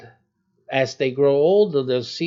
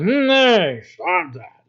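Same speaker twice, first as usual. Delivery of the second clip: a hammed-up stern dad, louder and higher; mock stern warning; 4 semitones higher, 6 dB louder, slower than usual. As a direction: put on a strict warning voice to stop the kids. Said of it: "I'll give them".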